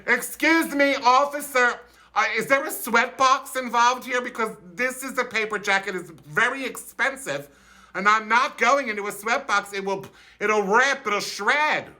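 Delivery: high, overly polite protest; prissy complaint to the cop. Retold or imitated. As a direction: fuss politely at the officer. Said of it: "he addresses the officer".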